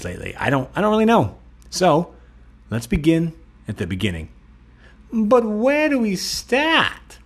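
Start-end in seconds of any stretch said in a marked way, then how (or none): 5.1-7.2 silly clown. Pretend. Host acting goofy intro.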